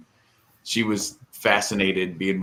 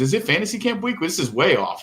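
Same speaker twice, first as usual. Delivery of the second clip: joking coach; 10 semitones higher than usual. Retold or imitated. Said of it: "he's just making a joke".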